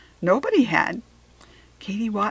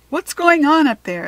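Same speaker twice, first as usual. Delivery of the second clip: called loudly from the back of a line; shouting; 7 semitones higher, 8 dB louder than usual. A narrator's reading. Girl at the back of the line yelling at the lunch lady, loud. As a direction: yell up toward the front, impatient. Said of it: "shouted from the back of the line".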